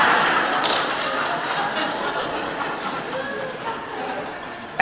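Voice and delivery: breathless, wheezing high laugh; a long laugh with no voice; silent laugh. Real, not acted. Priest cracks up retelling the patient's line, a wheezing silent laugh.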